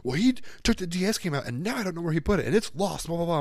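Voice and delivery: put-on high-pitched, gabbled kid's complaint; upset protest. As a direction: imitate a worked-up child accusing someone. Imitates the boy's upset protest.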